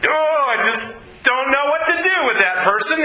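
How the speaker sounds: a put-upon sigh over a difficult person; exasperated sigh. Retold or imitated. Preacher mimicking a believer fed up with someone, in exasperation.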